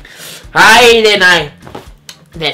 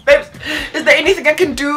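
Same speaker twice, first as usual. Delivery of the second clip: tearful pleading; 8 semitones higher, faster than usual. Retold or imitated. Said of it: "I'm in tears. I'm emotional".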